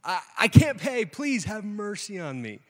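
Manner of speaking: loud, high, drawn-out wail of a plea; hammed-up begging for mercy; desperate begging. Servant on his knees before the king, hammed-up pleading. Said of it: "gets down on his knees".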